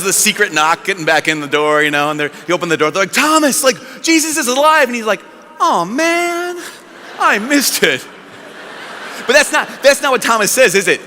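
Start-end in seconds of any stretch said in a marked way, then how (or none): 3.1-4.9 high, eager exclamation; excited news. Retold or imitated. Apostles greet Thomas back from shopping, excited. 5.6-8.0 high, drawn-out whine; mock disappointment. Thomas learns he missed Jesus, mock disappointed.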